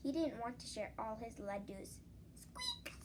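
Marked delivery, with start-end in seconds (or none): 2.3-2.9 tiny squeaky mouse noise; high mouse squeak. Acted. Mouse squeak sound effect.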